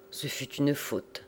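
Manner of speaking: ordinary delivery, nothing marked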